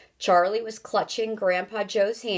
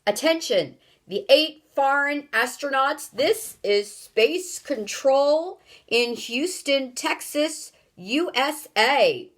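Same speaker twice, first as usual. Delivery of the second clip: loud, raised official broadcast; stern official; 6 semitones higher, 3 dB louder than usual. Acted. Space Control orders the strangers to identify, loud and stern. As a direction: address trespassers like stern mission control.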